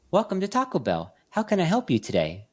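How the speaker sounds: automated drive-thru voice. Retold or imitated. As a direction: imitate a cheery recorded drive-thru greeting. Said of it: "an automated voice recording".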